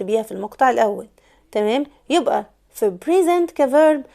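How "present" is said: "present" as pri-ZENT, higher and emphatic; stress on second syllable. Shown as a demonstration. Teacher summing up the verb form, "present" as pri-ZENT. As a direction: lean hard on ZENT in "present". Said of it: "السترونج سيلابل كان المقطع التاني".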